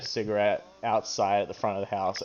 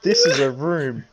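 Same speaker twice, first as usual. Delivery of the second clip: laughing through the words; 6 semitones higher, 7 dB louder than usual. Real, not acted. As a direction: blurt it out between laughs. Laughing mock of the line.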